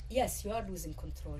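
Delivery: ordinary talk, nothing marked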